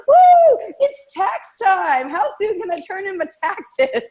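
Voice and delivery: high, hammed-up excitement with a loud woo; loud whoop into drawn-out high glee; gleeful whooping. Acts out past tax-time glee.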